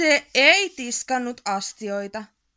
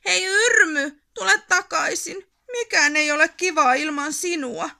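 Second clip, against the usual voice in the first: higher, louder, teary plea; pleading call; 6 semitones higher, 5 dB louder than usual. A narrator's reading. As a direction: beg a friend to come back. Calling missing friend back. (itkuinen huuto ystävälle)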